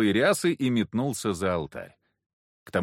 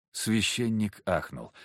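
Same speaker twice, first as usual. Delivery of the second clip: usual speaking voice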